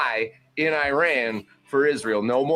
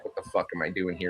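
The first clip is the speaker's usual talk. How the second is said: pissed-off mutter; 8 semitones lower, 7 dB quieter, faster than usual. Retold or imitated. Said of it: "looked like he was all fucking pissed off".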